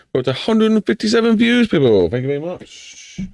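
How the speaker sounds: high, excited announcement. Real, not acted. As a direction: cheer the view count, pitch way up. Excited about view count.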